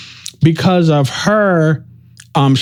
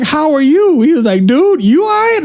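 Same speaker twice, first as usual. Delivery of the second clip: much higher, caring check-in; friend asking back; 11 semitones higher than usual. Retold or imitated. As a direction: voice the friend asking how you are. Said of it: "And he was like".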